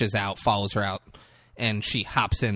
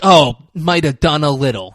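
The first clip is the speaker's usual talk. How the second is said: mocking repeat, louder and higher; sarcastic echo; 4 semitones higher, 8 dB louder than usual. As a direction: throw his words back at him. Daughter mocking dad's words.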